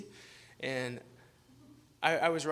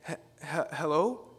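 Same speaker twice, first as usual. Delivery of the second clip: hesitant hello; slower than usual. Retold or imitated. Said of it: "you kinda do that thing".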